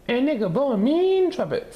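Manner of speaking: put-on very high, loud little girl. A narrator's reading. Zelda boasting to reporters, high and loud. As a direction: squeal it like a proud kid. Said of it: "said Zelda".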